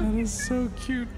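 high, loud delighted squeal; gushing over cute. Real, not acted. Streamer reacts to the buongiorno cat video, gushing squeal.

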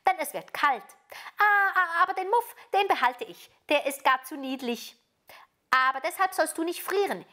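put-on high, lively robber girl voice; bossy robber girl. A narrator's reading. Robber girl keeping the muff, offering mittens, bossy. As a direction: claim and grant like a bossy child.

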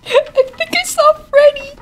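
high-pitched, through laughter; laughing excitement. Real, not acted. Gamer spots Freddy, high laughing squeal.

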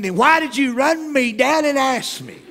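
loud, high incredulous outburst; exasperated retort. Acted. Traveler silently rails at the man who chased him, exasperated.

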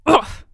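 exasperated grunt. A narrator's reading. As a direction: groan out loud in frustration. King finds his feet stuck.

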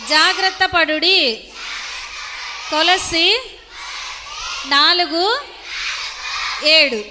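drawn-out phrases with rising ends and long pauses; slow recitation for repeating. Shown as a demonstration. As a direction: lead children through the verse, phrase by phrase. Leads girls in verse repetition. (నెమ్మదిగా, సాగదీస్తూ వల్లె వేయించడం)

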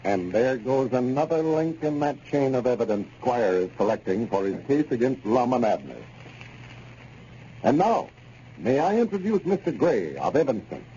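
bright, even announcer read; old-time radio announcer introducing a guest. Acted. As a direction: wrap up the scene and hand over like an old-time radio host. Announcer closes scene, introduces guest.

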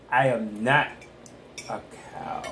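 quizmaster to family, holding back the answer, dropping to a whisper; low murmur fading into a voiceless whisper; trailing into whisper. Pretend. Holds back the riddle's last words.

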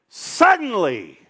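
suddenly shouted high and slow, falling away; drawn-out suddenly. Real, not acted. Preacher springing the turn on the church, loud and slow. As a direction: ring out suddenly, stretched. Sermon climax word.

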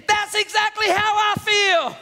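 high, drawn-out preacher's shout; hollered. Acted. God answering the frustrated dad, hollered. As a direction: holler every word slowly and forcefully. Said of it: "not every preacher hollers".

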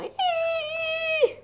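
very high, soft squeal; long thin chick squeal; play squeal. Acted.

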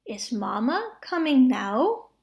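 ordinary delivery, nothing marked

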